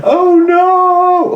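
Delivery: mock celebrity grief. Mocking a rich celebrity's public grief, in a hammed-up high wail. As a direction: overplay the fake horror.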